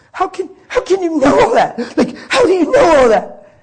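put-on the young man, floored, demands how the preacher knew; stunned disbelief. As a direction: cry out in shock at being seen through.

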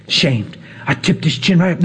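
ordinary talk, nothing marked

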